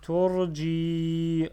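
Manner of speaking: TURJI with a long held final 'i'; loud recitation, stretch the end of TURJI; prolonged kasra. Shown as a demonstration. Teacher reading to learners, TURJI with its last vowel drawn out. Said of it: "Je prolonge kasra".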